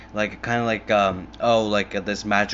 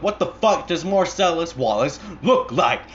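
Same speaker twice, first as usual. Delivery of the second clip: loud, raised-pitch angry demand; imitated interrogator; 7 semitones higher, 6 dB louder than usual. Hitman grilling a man about his boss, angry. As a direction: act out a furious movie interrogation. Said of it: "he was interrogating him".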